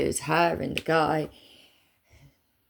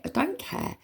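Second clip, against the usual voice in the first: normal talking voice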